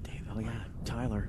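quick whisper off-mic; hushed correction under the breath; whispered aside. Real, not acted. Aside during live commentary.

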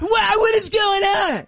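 high, shouting in disbelief; mock excited shout. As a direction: yell it like a stunned fan. Echoing the clip's shout.

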